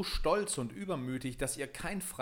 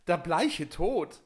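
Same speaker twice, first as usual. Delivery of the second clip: high, louder, slow scornful jibe; mocking princess; 6 semitones higher, 5 dB louder, much slower than usual. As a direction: sneer it out slowly. Spottende Prinzessin. (hoch, laut und langsam höhnend)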